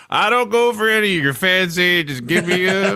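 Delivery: high-pitched, teasing mimicry of a friend; mocking imitation. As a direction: tease them with a high put-on voice. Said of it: "you're like".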